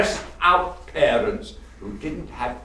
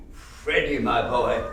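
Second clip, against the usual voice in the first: ordinary talk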